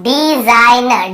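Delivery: Design drawn out, "di-zaai-na"; slow model reading. Shown as a demonstration. Teacher asks how it is read, design slowly sounded out. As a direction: stretch design slowly, part by part. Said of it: "हम इसे क्या पढ़ रहे हैं?".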